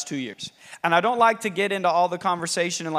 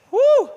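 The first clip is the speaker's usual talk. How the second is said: high whoosh exclamation; 8 dB louder than usual. Acted. God's exclamation.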